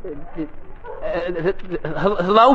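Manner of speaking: stammering, scared, words stuck before the bully. Acted. Archie stammers to the bully Butch, meek and scared. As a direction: trip over the greeting like you're terrified.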